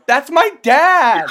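high, slow, loud yell; outraged shout. Acted. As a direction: yell it out in shock. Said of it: "one of them goes".

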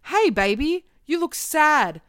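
put-on cheesy romance dialogue; mock book dialogue. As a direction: ham up a concerned sweetheart from a teen novel.

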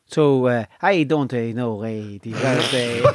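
halting, badly faked Spanish accent; put-on Spanish accent. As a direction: grope for words in a dodgy Spanish accent. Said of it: "He's trying to speak in a Cavan Spanish accent".

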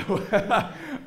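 short, high, loud laugh; quick burst of amusement. Real, not acted. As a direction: laugh mid-story. Laughs while telling own story.

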